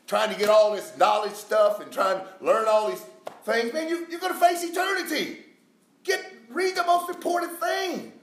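high, shouted sermon warning; full-force preacher, loud and high; urgent preaching. Real, not acted. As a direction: cry out a warning to the congregation.

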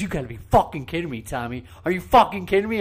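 imitating the media person's angry outburst, loud; mock furious rant at the skate guy; angry yelling quote. Media person yelling at Tommy over tight laces, mock angry. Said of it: "She goes".

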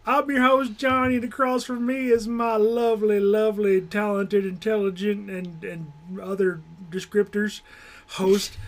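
hammed-up Southern host with a high yeehaw; Southern accent. Podcast host greeting listeners and introducing the co-host, hammed-up Southern accent. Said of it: "Southern is my weakest accent".

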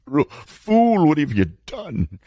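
high, mocking inner demon with a laugh. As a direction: sneer at yourself like the voice in your head. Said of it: "That voice is in my head".

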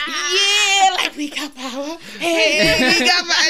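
loud, high mock cheer; celebratory cheering. Couple dancing as power returns, excited cheering.

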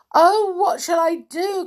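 high, loud wail of dismay; cried out. Acted. Amelia Jane cries out when Nanny doesn't come. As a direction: cry out at a loss. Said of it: "cried Amelia Jane".

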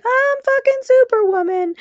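high, brash Superwoman boast; cocky self-hype. Retold or imitated. Boasting to herself on a good day, high and brash. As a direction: pump yourself up like a superhero. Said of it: "days where you're like".

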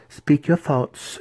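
high, slow matron's order; commanding. A narrator's reading. Matron bidding her new ally speak, commanding. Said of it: "she commanded".

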